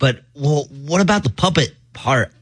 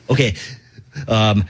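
ordinary talk

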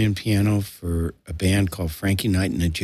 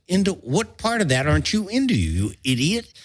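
incredulous jab pitched much higher; mocking retort; 9 semitones higher than usual. Mocking a friend's excuse, incredulous high retort. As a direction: scoff at him, voice jumping up.

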